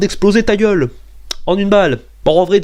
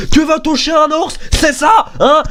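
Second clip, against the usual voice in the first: mock outraged shout; 9 semitones higher than usual. To the bear hunter, hammed-up outraged shout. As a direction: yell at the hunter in fake outrage. Ranting about bear hunting.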